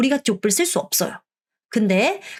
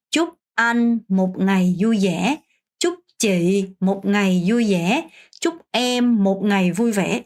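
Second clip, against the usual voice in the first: slow, clear Vietnamese wish; slow model phrase; much slower than usual. Shown as a demonstration. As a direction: say it slowly for learners to copy. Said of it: "이런 식으로 얘기를 할 수 있어요". (천천히 또박또박 인사 시범)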